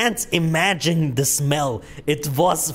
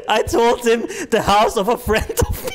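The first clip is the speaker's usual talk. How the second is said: talking through real giggles, higher; helpless laughter. Real, not acted. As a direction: struggle to get it out while laughing. Cracks up at own story.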